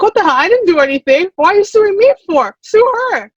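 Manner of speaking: rising outrage, ending high; indignant disbelief. Retold or imitated. Retold outburst at suing customer.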